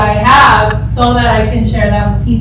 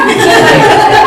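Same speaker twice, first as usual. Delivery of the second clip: airy giggle; 9 semitones higher, 4 dB louder than usual. Real, not acted. Laugh after Brenda's quote.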